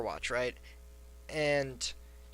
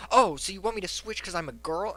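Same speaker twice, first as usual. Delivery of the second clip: mock indignant, higher and louder; retold huffy accusation, raised pitch; tilted; 6 semitones higher, 6 dB louder than usual. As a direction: imitate an offended, accusing gamer. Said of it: "this chick was just tilted".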